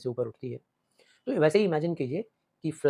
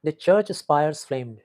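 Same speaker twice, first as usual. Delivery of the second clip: each word drawn out, very slow; slow poem recitation; 5 dB louder, much slower than usual. Shown as a demonstration. Teacher reciting the poem line. (कविता की धीमी पाठ शैली)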